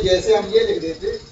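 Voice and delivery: ordinary talk, nothing marked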